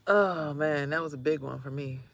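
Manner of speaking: usual speaking voice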